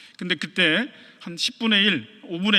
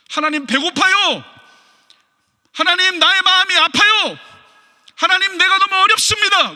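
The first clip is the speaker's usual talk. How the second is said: much higher, louder anguished prayer; raw prayer; 10 semitones higher, 6 dB louder than usual. Retold or imitated. A believer pouring out his heart to God, anguished cry. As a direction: pour out the hurt to God.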